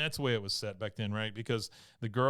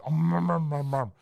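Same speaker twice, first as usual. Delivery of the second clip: reindeer grunts; 5 semitones higher, 8 dB louder than usual. Acted. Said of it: "Caboose just says".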